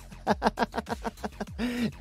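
high, rapid wicked chuckle; mocking giggle. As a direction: laugh scornfully at the fallen foe. Vampire mocks the slain wolf.

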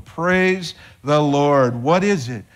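ordinary talk, nothing marked

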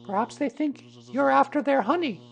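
put-on very high little-boy suggestion; child's voice. A narrator's reading. Boy explaining the bees to Pooh, high child's voice. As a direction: offer a guess as a small child.

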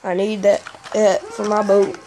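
talking with the hiccups; words broken by hiccups. Real, not acted. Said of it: "Excuse me, I got the hiccups".

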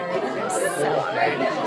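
slow breathy mocking echo; mocking whisper. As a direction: whisper it back in mockery. Echoes friend's line mockingly.

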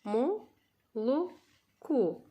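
slow, split into syllables; teacher sounding out syllables for first graders; syllable reading. Shown as a demonstration. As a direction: sound out each syllable apart. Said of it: "teacher reads syllables for class".

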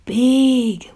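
plain reading voice

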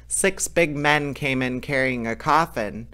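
ordinary delivery, nothing marked

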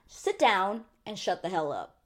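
ordinary talk, nothing marked

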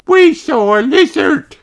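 put-on very high little boy elephant; very high child character voice; Edmund Elephant voice. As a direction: voice an excited tiny elephant.

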